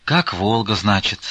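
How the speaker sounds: usual speaking voice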